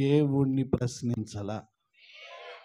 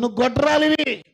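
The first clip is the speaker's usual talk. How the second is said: much higher, loud scornful jeering; loud mockery; 10 semitones higher, 7 dB louder than usual. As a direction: taunt the barren wife with contempt. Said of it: "శారాను పట్టుకొని".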